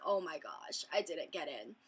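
low, soft, let-down murmur; dejected. Retold or imitated. Vlogger retelling her belief she was rejected, dejected. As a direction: sink quietly into disappointment.

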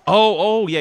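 startled. Real, not acted. Sudden realization in game.

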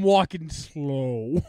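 dragged-out slow-mo speech; slow motion. Pretend. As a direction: stretch every word out slowly. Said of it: "Why did you talk in slow-mo?".

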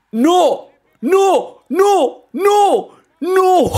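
repeated high, loud screams of no; despairing shout. Real, not acted. Fan screaming at the missed penalty, high and loud. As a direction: yell no over and over as the kick fails.

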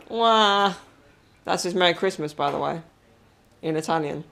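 normal talking voice

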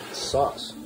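normal talking voice

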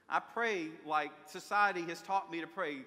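ordinary talk, nothing marked

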